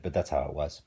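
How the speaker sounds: ordinary delivery, nothing marked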